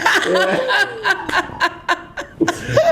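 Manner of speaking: high, prolonged laughing fit; cracking up. Real, not acted. Reacting to the paid-for joke, high helpless laughter. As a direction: let a long laugh spill out. Laughter at his own punchline.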